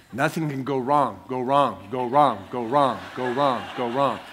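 a lightly voiced looping announcement; stuck recording. Autopilot voice over the speaker, glitching repeat. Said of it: "the voice said".

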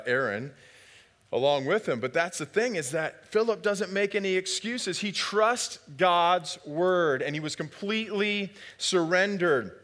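normal talking voice

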